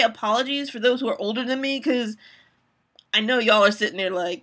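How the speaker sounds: ordinary talk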